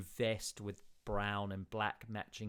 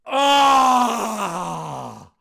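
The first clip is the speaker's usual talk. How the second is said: loud high anguished cry into the night; anguished howl; 11 semitones higher, 18 dB louder than usual. Acted. Town speaker howling alone into the night after they leave. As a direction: howl out in frustration. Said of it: "Oris just howls out into the night".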